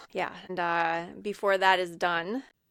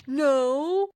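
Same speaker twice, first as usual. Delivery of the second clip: high, loud drawn-out denial; mock-petulant, raised voice; retold protest; 6 semitones higher, 6 dB louder than usual. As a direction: stretch it out like a protesting teen. Retold embarrassed denial by teased teen.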